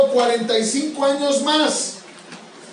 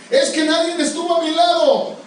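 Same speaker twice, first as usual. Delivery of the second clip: mock whining, pitched higher; whiny excuse; 6 semitones higher than usual. Preacher mimicking a believer's excuse, whiny. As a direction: sulk as if making a lame excuse. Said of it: "Eso no es pretexto".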